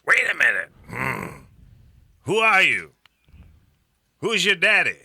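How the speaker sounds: high, loud, suspicious interrogation; tough female dog voice. Retold or imitated. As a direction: grill the newcomer hard and loud. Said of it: "female response".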